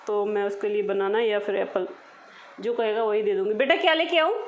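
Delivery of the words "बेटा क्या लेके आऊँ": high, louder call to her son; mother calling her son. Real, not acted. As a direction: call out across the house.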